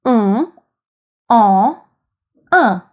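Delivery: "Un, en, un" spaced out, each a nasal vowel; French nasal vowels. Shown as a demonstration. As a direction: sound "Un, en, un" through the nose, no final n. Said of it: "to distinguish those different sounds".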